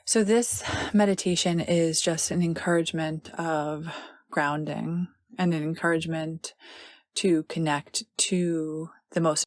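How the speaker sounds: unhurried meditation voice with long pauses; guided meditation. Acted.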